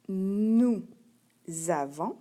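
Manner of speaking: "nous avons" slowly, with a z starting avons; liaison. Shown as a demonstration. Teacher revealing the hidden Z to a pupil, "nous avons" with liaison. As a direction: stretch "nous", pause, then say zavons. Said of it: "as-tu entendu le Z ici".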